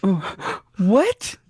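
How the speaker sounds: high loud disbelief; shocked. Real, not acted. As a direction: gasp out the word. Shock at the murder.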